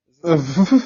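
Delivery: loud crackling phone distortion; blown-out phone mic. Real, not acted. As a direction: shout through an overloaded phone mic. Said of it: "Absolutely not".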